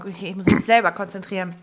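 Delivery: usual speaking voice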